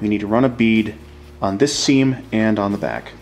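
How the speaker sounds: normal talking voice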